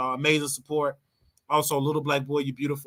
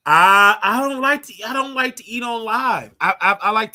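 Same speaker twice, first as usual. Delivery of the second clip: high, loud protest, shouting it out; emphatic loud refusal; 6 semitones higher, 7 dB louder than usual. Real, not acted. Streamer to her viewers, a loud refusal to eat live. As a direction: refuse it loudly and hard.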